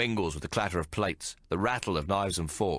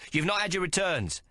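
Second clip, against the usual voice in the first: chef's voice; 10 semitones higher than usual. Acted. Chef offering food.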